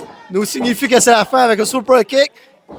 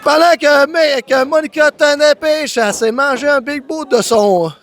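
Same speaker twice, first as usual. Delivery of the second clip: wrestling commentator; 3 semitones higher than usual. Real, not acted. Live wrestling play-by-play.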